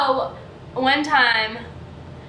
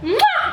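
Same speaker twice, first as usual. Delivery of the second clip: blown kiss; 7 semitones higher than usual. Real, not acted. Blowing a kiss goodbye.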